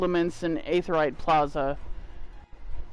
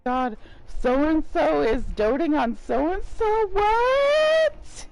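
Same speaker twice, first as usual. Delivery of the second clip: excited; 6 semitones higher, slower than usual. Retold or imitated. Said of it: "When I'm drunk, it's like".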